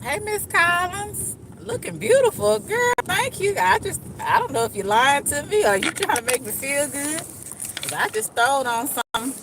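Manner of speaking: high, loud friendly call; high excited greeting. Real, not acted. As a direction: greet a neighbour brightly. Greeting passerby from car.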